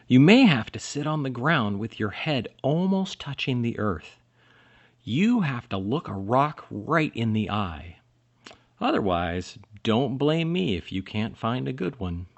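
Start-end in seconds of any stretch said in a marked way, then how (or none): none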